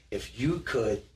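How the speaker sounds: normal talking voice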